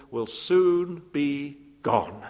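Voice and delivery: ordinary talk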